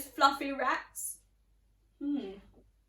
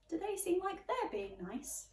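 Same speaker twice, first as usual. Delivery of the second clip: ordinary delivery, nothing marked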